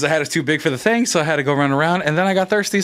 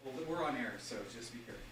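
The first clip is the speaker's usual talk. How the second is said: very distant, faint and low; barely audible off-mic aside; far off; 3 semitones lower, 24 dB quieter, faster than usual. Real, not acted. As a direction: warn someone from across the room, away from the mic. Away from mic, warning someone arriving.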